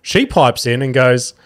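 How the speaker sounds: ordinary delivery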